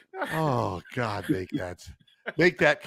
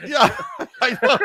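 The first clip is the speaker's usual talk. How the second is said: laughing; 11 semitones higher, 5 dB louder than usual. Real, not acted.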